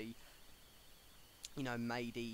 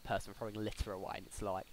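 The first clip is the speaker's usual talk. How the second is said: ordinary talk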